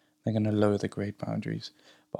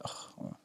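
tired groan; 20 dB quieter than usual. Retold or imitated. Groggy groan being woken up.

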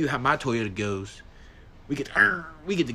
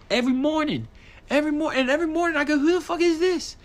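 road rage rant; 13 semitones higher than usual. Real, not acted. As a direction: vent about the reckless minivan, fired up.

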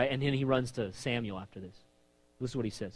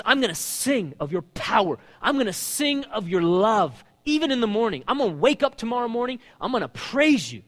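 preaching praise; 7 semitones higher, 6 dB louder than usual. Acted. David vowing to sing praise, loud and preached. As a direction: declare it boldly. Paraphrasing psalm with preaching force.

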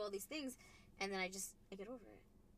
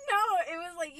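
laughing; 5 semitones higher, 9 dB louder than usual. Real, not acted. Laughing after baby barf joke.

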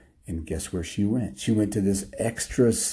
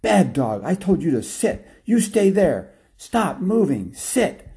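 high, scolding yell; 8 semitones higher, 4 dB louder than usual. Acted. Lady yelling at her dog on a leash, angry yell. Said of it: "she was yelling".

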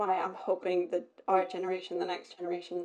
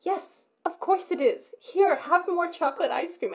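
high-pitched acted indulgence; imitated gushing yes to the kids; emphatic permission; 9 semitones higher, 4 dB louder than usual. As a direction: grant it wholeheartedly, as a retold mother. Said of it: "And I went".

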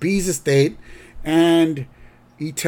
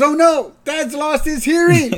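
high, loud mock Homer alarm; Homer worried; 7 dB louder than usual. Son thinks his dad went deaf, high loud mock alarm. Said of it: "And then Homer goes".

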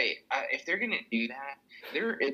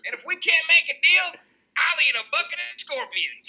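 much higher, loud hammed-up cowboy whoop; cowboy governor; 5 dB louder than usual. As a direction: whoop it up like a pistol-waving cowboy politician.

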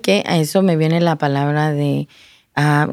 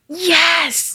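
retold cry out; 11 semitones higher than usual. Woman answering the voice in her vision, retold cry. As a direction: cry it out high without full volume. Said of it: "Y cuando grito, que digo, "Sí".

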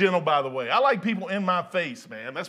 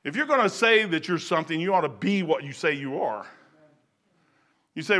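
ordinary talk, nothing marked

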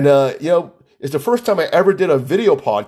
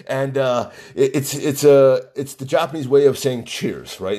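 ordinary talk, nothing marked

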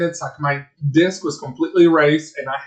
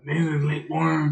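slow low mock tough guy; deep put-on voice; 4 dB quieter, slower than usual. Host jokingly voices a Danny Trejo type. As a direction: rumble it slowly. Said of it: "I don't like it that I sound like this".

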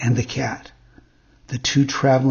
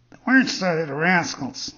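indignant cat; 3 semitones higher than usual. A narrator's reading. Cat's echoing character voice.

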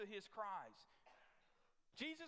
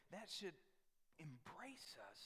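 usual speaking voice

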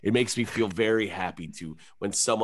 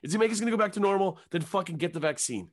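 high, fast blunt rant; heated rant; 9 semitones higher, faster than usual. Real, not acted. Comedian ranting to cohost about vaccines, high and blunt. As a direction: hammer it out impatiently.

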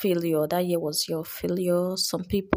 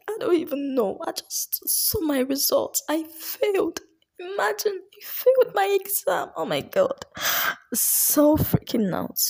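higher and louder, rising panic over failing; freaking out, getting higher with each line; Precious panicking; 10 semitones higher, 5 dB louder than usual. Acted. Telling her friend she failed her exam, rising panic. Student just saw failed results.

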